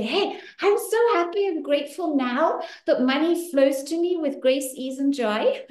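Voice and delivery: high-pitched, excited affirmation; eager instant recital. Shown as a demonstration. Coach shows reciting it the moment she's woken, high and eager. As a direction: blurt it brightly as if just woken and asked. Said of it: "Whenever I wake you up, you say".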